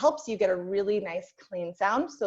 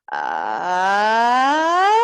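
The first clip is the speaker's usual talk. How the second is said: a siren sung from vocal fry, gliding steadily up and louder; 8 dB louder than usual. Shown as a demonstration. Teacher demonstrating the siren exercise for the class, rising sung siren. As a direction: slide the "Ah" from fry up into head voice. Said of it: "I will give you an example".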